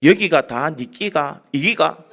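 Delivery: slow, laughing Gyeongsang dialect question; Gyeongsang accent. Retold or imitated. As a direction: drawl the Gyeongsang words out slowly through a chuckle. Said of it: "경상도 사람이 이렇게 얘기합니다".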